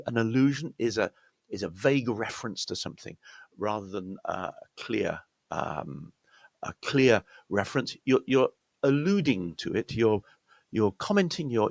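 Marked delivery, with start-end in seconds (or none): none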